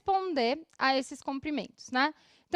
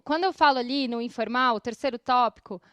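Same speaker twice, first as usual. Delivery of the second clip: usual speaking voice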